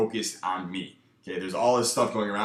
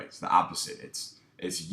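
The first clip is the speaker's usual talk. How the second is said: ordinary talk, nothing marked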